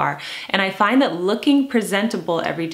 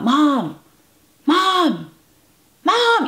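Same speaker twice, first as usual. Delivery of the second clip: high-pitched calling out for mom, building higher; imitated child's yell; 10 semitones higher, 5 dB louder, much slower than usual. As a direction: mimic a frantic kid yelling from downstairs, more urgent each time. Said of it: "So they'll yell out".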